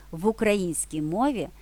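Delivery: normal talking voice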